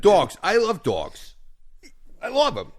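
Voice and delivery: high, emphatic mock New York enthusiasm; punchy cartoon New York accent; New Yorker accent. New Yorker professing love of dogs, high mock New York accent. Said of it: "proper New Yorker accent".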